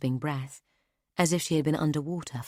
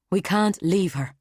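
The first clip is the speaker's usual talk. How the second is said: loud snarling refusal, pitched up; snarled; 5 semitones higher, 7 dB louder than usual. Acted. Nonna to Keot and Clara, loud angry snarl. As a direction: bite the words out in fury. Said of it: "Nonna snarled".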